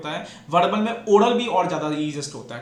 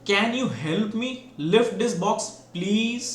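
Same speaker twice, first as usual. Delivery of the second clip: slow, rising question with higher pitch on please; asking as a question; 4 semitones higher, much slower than usual. Shown as a demonstration. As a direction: ask "Can you help me lift this box, please?" slowly, lifting at the end. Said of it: "sir, सुनो". (सवाल वाला उठता हुआ लहजा)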